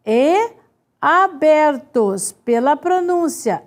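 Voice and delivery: normal talking voice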